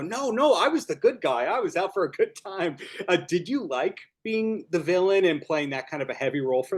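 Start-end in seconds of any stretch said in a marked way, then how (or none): 0.0-2.8 higher-pitched mock protest. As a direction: object as if wrongly accused, pitched up. Interviewer voices actor's protest.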